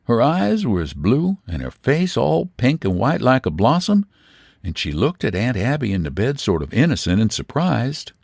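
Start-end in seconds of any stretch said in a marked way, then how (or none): none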